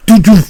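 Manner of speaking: loud, high double punch sound; imitated punch noises. Storyteller showing the priest's right and left punches, imitated loudly. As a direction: fire off two punchy thuds vocally. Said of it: "Father Canise went".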